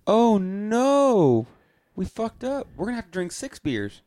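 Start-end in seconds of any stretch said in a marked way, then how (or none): 0.1-2.7 high, loud realization of a blunder; sudden groan of a mistake; a real oops that breaks into laughter; dismayed oh no. Real, not acted. Realizing they skipped a beer.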